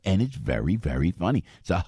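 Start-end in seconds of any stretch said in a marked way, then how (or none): none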